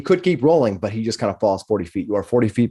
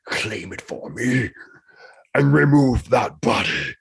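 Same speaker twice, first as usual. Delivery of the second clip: dragon voice; slower than usual. Acted. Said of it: "in a draconic voice".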